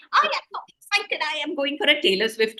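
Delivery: high, bubbly excited announcement. Retold or imitated. Child telling the class about a concert, high and thrilled. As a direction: burst out with the big news. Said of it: "you say very excitedly".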